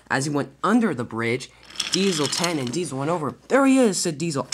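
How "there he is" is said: high, loud call to the chase; excited shout. A narrator's reading. Diesel alerting Diesel ten from the bridge, excited shout. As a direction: shout it on spotting your quarry. Diesel spots fleeing Thomas.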